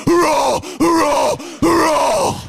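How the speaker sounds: loud, high comic roaring; mock roar. Comedian doing the song's roar loud and high. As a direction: roar it like a monster. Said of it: "you look at them and you go".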